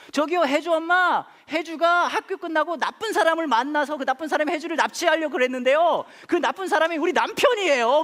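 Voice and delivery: high, loud imitation of his wife; wife on phone. Wife explains to Hyeju's mum by phone, loud and high.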